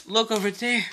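excited cry. A narrator's reading. Thomas points out the passing engines, crying out. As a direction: call out with a touch of excitement. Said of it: "he cried".

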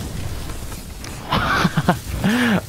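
high-pitched breathy giggle; high breathy laugh. Real, not acted. After O P chant.

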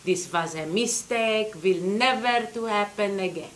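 put-on slow broken Hungarian-accented apology; careful polite old Hungarian woman. Grandma apologizing to the detective, Hungarian accent. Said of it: "very thick accent".

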